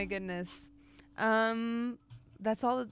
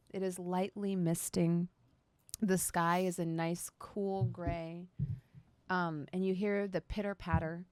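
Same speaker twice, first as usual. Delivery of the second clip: game master narration; 4 semitones lower, 4 dB quieter than usual. Acted. Game master scene setting.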